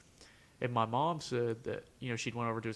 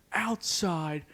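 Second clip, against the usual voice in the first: drawn-out, louder order; stern slow command; 7 semitones higher, 6 dB louder, much slower than usual. Retold or imitated. The angry teacher orders the student into the hallway, slow stern command. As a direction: order him out, slowly and firmly.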